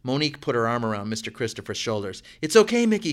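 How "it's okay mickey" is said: higher and louder, calling out reassurance; yelled. A narrator's reading. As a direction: yell it across the room to calm him. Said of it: "she yelled".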